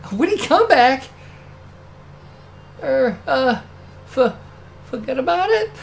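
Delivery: a flustered wiseguy caught off guard; New York mobster. Acted. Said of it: "the accents actually were appropriate for this one".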